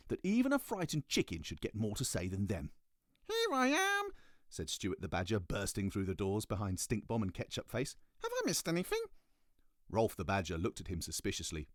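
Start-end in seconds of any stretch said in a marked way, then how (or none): 3.3-4.1 very high squeak; high squeaky badger. Stuart the Badger voiced. 8.2-9.0 very high squeak; high squeaky badger. Stuart voiced.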